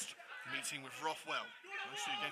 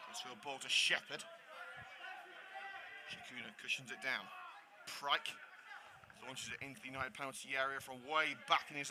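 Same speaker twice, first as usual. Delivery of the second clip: play-by-play; 5 dB quieter than usual. Acted. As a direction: follow the ball calmly, pass by pass. Live play-by-play of the match.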